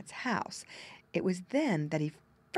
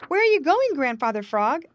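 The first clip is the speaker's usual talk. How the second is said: high, loud call from above; muskrat calling down; 8 semitones higher, 10 dB louder than usual. Acted. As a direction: shout down to the frog from the rock. Jerry calls down from rock.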